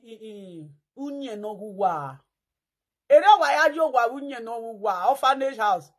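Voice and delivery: usual speaking voice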